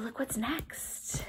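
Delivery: hushed whisper so the lion sleeps. Acted. Not waking the sleeping lion, whispering to kids. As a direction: whisper it, don't wake him.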